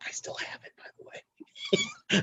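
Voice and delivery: hushed, voiceless whisper; whispered aside. Real, not acted. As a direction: whisper it like a secret boast.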